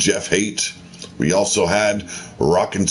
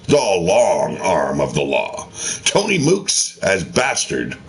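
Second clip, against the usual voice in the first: ordinary talk, nothing marked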